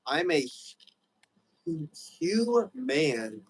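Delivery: HU-, HU-, MAN split by long pauses; broken into syllables. Shown as a demonstration. Musing to friend on the word human, broken into syllables. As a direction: break hu-, hu-, man apart, pausing before each piece. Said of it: "when you break down, like, the syllables".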